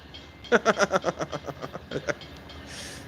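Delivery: long, high breathy laugh; real laughter. Real, not acted. Remembering the water fountain story, genuine amused laughter. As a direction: laugh helplessly at a memory. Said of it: "That one makes me laugh".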